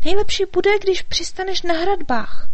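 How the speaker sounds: high, loud fairy voice; fairy advising. A narrator's reading. Fairy telling the dragon where to land, high and loud. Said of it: "radila mu Markétka".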